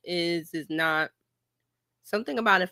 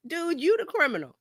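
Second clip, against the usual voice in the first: ordinary talk, nothing marked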